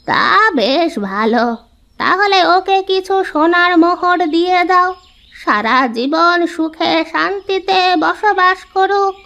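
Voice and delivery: put-on old woman granting a reward, drawn out slowly; kindly old woman. Narrator voices the old woman. (ধীর, উঁচু স্বরে বুড়ির গলা)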